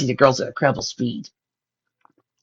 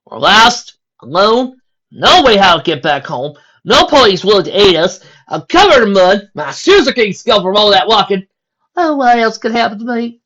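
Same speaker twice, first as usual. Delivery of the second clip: loud, higher dramatic lament; exasperated moan; 8 semitones higher, 10 dB louder than usual. Acted. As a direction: wail about your woes. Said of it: "Exasperated moan".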